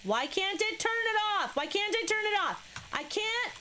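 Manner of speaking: high, incredulous complaint; exasperated whine. Real, not acted. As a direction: protest it way up high in disbelief. Frustrated with game object.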